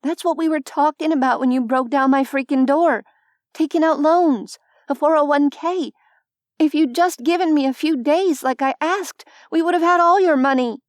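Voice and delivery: heated protest to the goons; angry outburst. Acted. Protesting to the loan sharks.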